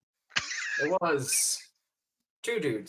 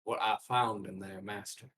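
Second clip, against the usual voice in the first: a hint of Morgan Freeman; 5 semitones lower, 6 dB quieter than usual. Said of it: "Let me get that seductive Morgan Freeman".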